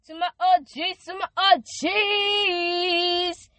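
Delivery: high, loud, slow stretched-out celebration; host welcoming new believers, hyped and loud; hype welcome. Real, not acted. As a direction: hype up the crowd, drawing each word out. Hype intro for newly saved.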